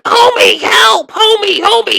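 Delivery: high, loud panicked Marge cry; Marge Simpson voice. Retold or imitated. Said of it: "Marge is like".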